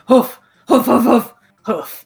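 dog noise. Acted. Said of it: "he just goes".

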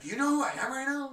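loud, high indignant shout; entitled shout. Acted. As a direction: demand it like a big shot. Acted entitled outburst.

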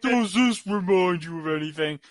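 lightly put-on quote voice; a hint of hammy mimicry; echoed movie line.

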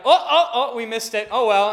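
high, loud, laughing oh-oh-oh; mock surprise. Friend pretending he missed the exit, laughing mock surprise. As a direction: fake innocence through a laugh.

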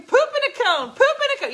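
high, loud shrieking chant; screaming. Retold or imitated. Retelling running through the house, high loud screaming. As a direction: shriek it like a kid. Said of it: "I started screaming".

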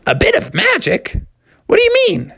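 put-on very high, questioning young frog; puzzled Froggy. Froggy questions dad's talk of magic, high and puzzled. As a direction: squeak the question in surprise. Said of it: "Froggy said".

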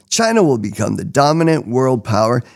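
ordinary delivery

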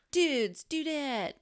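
surfer dude. Retold or imitated. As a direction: ham up the turtle lingo. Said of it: "they were like".

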